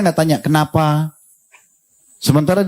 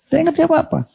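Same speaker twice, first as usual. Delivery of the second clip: very high, mock-exasperated; indignant disbelief; 14 semitones higher than usual. Real, not acted. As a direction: scoff at the idea, pitch jumping up. (nada tinggi, jengkel pura-pura)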